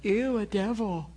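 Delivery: a touch of imitated disgust, not fear; mild mock disgust. Preacher imitating a squeamish believer, mild mock disgust. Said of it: "I wasn't like".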